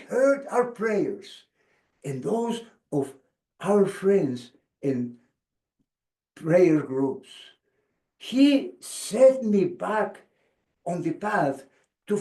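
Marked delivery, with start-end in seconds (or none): none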